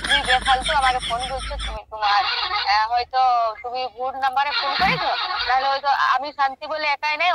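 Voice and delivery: ordinary delivery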